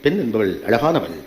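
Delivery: low, slow mock recital; mock society's saying. Speaker mimicking what society tells women about beauty, mock recital. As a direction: intone it slowly, dropped well down in pitch.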